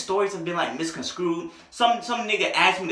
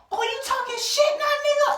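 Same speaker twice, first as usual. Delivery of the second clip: very high-pitched screechy challenge; mock confrontational sister. Sister comes back at him, high mock aggression. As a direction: imitate an angry sister squaring up.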